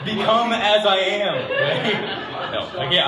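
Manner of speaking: verse broken up by real laughter, loud, high and slow; laughing. Real, not acted. Pastor attempting the verse while laughing, giggling through it. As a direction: try to read it but crack up. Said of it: "I can't actually say that".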